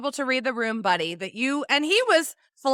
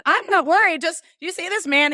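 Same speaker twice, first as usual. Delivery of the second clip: higher, louder pointed correction; pointed retort; 6 semitones higher, 4 dB louder than usual. Retold or imitated. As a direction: set him straight. Retorting to the bartender.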